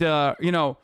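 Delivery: ordinary delivery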